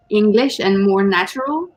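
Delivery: ordinary talk, nothing marked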